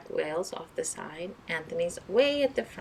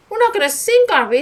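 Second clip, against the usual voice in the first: very high, loud fearful plea; terrified of sinking; panicked; 15 dB louder than usual. Acted. Anthony panicking in the storm, very high and loud.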